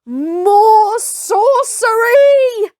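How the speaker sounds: a high, slow, drawn-out yell; frightened shout. Acted. Villagers shouting as the bullet misses. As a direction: cry it out in panic. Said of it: "shouted the villagers".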